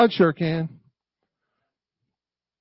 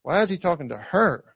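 "her" set apart with pointed emphasis; stress on HER; 3 semitones lower, 5 dB quieter than usual. Shown as a demonstration. Preacher demonstrating the disciples' judgement, stressing "her". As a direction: land the weight on "her". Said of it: "the emphasis on the word her".

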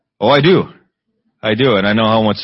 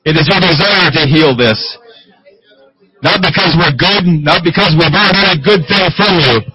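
raised, fervent prayer voice; preaching prayer; 8 semitones higher, 5 dB louder than usual. Real, not acted. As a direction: pray it out with rising fervor.

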